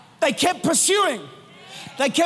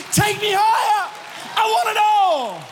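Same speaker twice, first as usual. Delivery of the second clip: higher, ringing plea; fervent cry; 8 semitones higher than usual. Retold or imitated. Worshippers press in, fervent ringing cry. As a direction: cry out to be lifted. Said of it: "they were singing".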